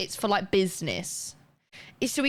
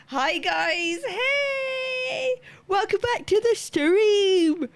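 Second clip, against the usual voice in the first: high, slow sing-song welcome; sing-song greeting; 11 semitones higher, much slower than usual. Acted.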